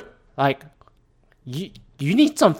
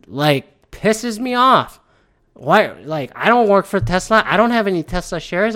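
usual speaking voice

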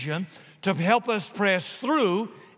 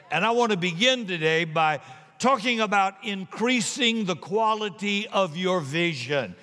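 normal talking voice

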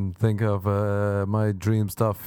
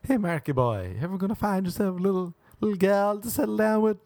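high folksy homestead parent; prairie parent; 6 semitones higher than usual. Retold or imitated. Parents asking son about settling down, high folksy voice. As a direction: put on a little-prairie parent voice. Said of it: "speak like they're in a little house in the prairie".

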